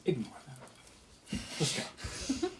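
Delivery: soft, slow, secret whisper; dryad whispers. Acted. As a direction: lean in and whisper it just to him.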